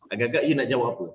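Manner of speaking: ordinary delivery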